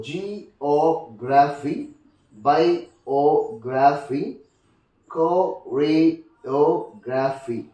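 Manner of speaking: very slow, syllable-by-syllable "geography", "biography", "choreography"; broken into syllables. Shown as a demonstration. Teacher giving stress rule examples, "geography", "biography", "choreography" slowly broken up. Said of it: "examples for the third rule".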